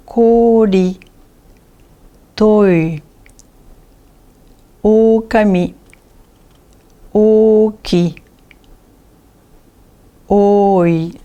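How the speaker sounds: O held out on an even tone; word by word, stretching each O; long O in each word. Shown as a demonstration. Teacher reads the exceptions, long O held. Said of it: "il se prononce O".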